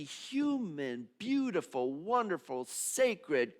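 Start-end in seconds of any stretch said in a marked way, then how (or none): none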